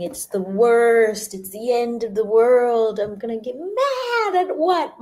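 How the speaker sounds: high, slow, hammed-up self-pity; mock dramatic despair. A teacher mocking how our mind complains. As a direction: ham up the whining mind, drawn out.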